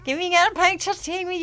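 high-pitched gushing request; imitated starstruck squeal; mock fan pestering. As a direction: pipe it up like an overexcited fan. Acts out drunken fans' request.

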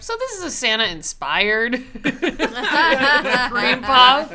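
laughing question. Retold or imitated. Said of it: "she was like".

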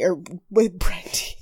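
whispered aside. Real, not acted. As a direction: breathe it under your breath. Whispered correction of slip.

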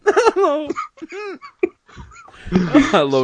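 high, loud laughing hello; dying with laughter. Real, not acted. Co-host greets the host while dying with laughter. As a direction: squeal a greeting through helpless laughter. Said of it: "you're dying with laughter".

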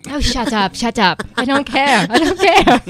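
hammed-up British accent, loud; Ricky Gervais impression. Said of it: "Did you just try to do a Ricky Gervais?".

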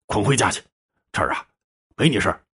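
put-on restrained rebuke from an older brother; stern scolding. A narrator's reading. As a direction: dismiss him sternly without raising your voice. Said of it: "黑脸训斥道".

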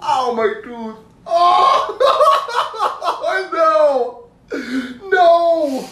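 high yelps through laughter; pained squeal. Real, not acted. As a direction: squeal in sudden pain. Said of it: "I had a massive gleek".

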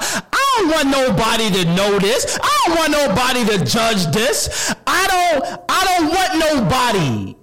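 high, pleading voice of someone hiding; desperate plea. Acted. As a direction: beg that nobody finds out. Imagined person fearing exposure.

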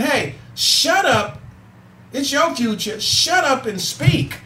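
driving the point home to the listeners; emphatic slogan. Shown as a demonstration. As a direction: preach the slogan slowly and hard. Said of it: "So I'm saying".

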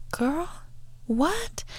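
put-on high, soft disbelieving "Girl, what?"; mock-shocked, lightly voiced; incredulous. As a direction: pull a face of disbelief at them. Incredulous retort to coworkers.

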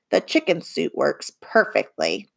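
low conspiratorial whisper; hushed whisper. Acted. Bob whispering to Livy that the chicken suit works. Bob insisting on his disguise.

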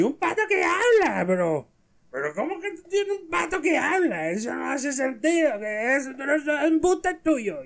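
put-on very high, slurred drunk voice; high-pitched comic drunkard protesting; second drunk. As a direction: squeal in drunken disbelief. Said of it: "el otro tipo le dice".

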